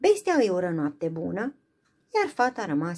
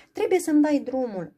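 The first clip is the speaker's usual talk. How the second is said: higher, louder plea to be let go; urgent cry; 8 semitones higher, 5 dB louder than usual. Acted. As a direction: beg him to release her. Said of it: "îi strigă ea".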